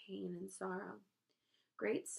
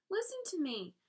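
high, raised-voice plea; urgent cry; 10 semitones higher, 4 dB louder than usual. A narrator's reading. Wilbur to the rat, urgent high cry. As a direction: cry out to be heard. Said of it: "cried Wilbur".

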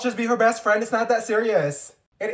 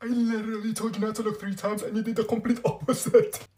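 ordinary talk

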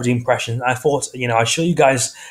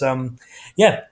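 ordinary talk, nothing marked

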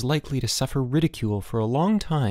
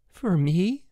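high, timid little question; quavering; 8 semitones higher than usual. A narrator's reading. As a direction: ask it high, with a nervous quaver. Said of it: "Elmer quavered".

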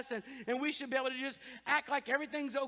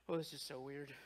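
awkward mutter; 11 semitones lower, 10 dB quieter than usual. Retold or imitated. Friend's awkward reaction.

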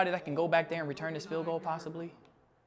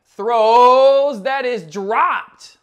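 excited call; 7 semitones higher, 13 dB louder, much slower than usual. Real, not acted. Pass dropped.

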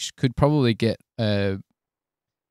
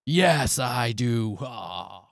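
hammed-up gloat; much slower than usual. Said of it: "I'll be like".

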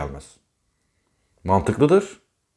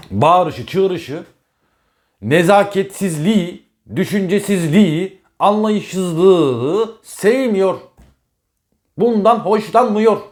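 louder, higher, heated, stretching the words out; rising exasperated rant, higher and louder; exasperated outburst; 7 semitones higher, 4 dB louder, much slower than usual. Real, not acted. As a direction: hammer home what he hates, voice climbing. Exasperated rant about rude partners.

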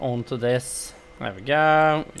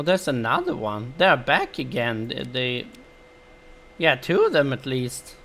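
ordinary delivery

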